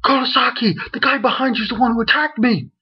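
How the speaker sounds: hint of Uryu screaming a warning; imitating a shouted warning, quick; mild mock scream. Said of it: "Uryu screams at him".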